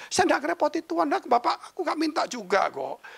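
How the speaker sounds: high-pitched, indignant retelling; defensive protest. Retold or imitated. Pastor insists he never bothered God, high defensive protest. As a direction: act out pleading innocence, pitched high. Acts out his defence to doctor.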